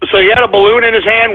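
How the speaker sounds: ordinary talk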